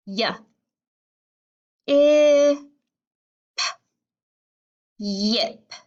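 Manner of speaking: short Y, loud held short I, popped p, then yip; sounding out and blending. Shown as a demonstration. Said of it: "One more time".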